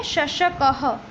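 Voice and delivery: शशकः said as shashakaha, with a clear final ha; visarga ending. Shown as a demonstration. Teacher to students, modelling the visarga sound. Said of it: "इसको कहेंगे".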